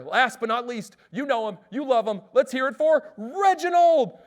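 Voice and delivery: big, slow, loud ballpark intro; stadium announcer. Retold or imitated. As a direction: draw out the name for the crowd.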